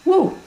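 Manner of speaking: short, very high "woo"; high whoop. Real, not acted. Cook signs off a cooking step to viewers, high whoop. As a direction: squeal a quick falsetto woo.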